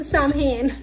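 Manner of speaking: "Samhain" said SAM-hane, the mistake she just made; "Samhain" with a flat a and a sounded h; wrong pronunciation. Shown as a demonstration. Owning up to her own slip on "Samhain". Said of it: "It is not pronounced Samhain".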